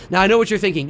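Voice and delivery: normal talking voice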